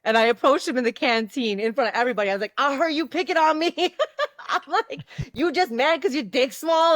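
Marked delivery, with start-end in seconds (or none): 2.6-7.0 put-on fourteen-year-old confronting a bully, loud and cocky; confrontational. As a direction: call out the bully in front of everyone.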